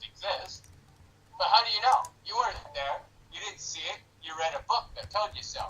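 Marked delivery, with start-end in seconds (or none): none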